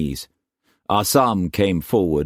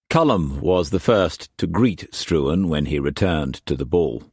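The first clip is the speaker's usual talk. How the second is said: ordinary delivery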